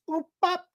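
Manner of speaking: high robotic beep boop. Retold or imitated. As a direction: chirp it like a little robot. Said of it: "doing a little robot action".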